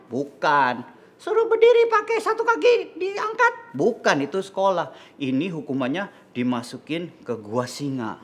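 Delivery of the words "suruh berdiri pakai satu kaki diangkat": very high squeaky puppet voice; childlike puppet. Acted. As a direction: guess eagerly in a tiny high-pitched voice. Puppet pupil guesses again.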